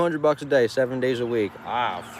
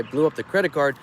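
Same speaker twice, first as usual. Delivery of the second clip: usual speaking voice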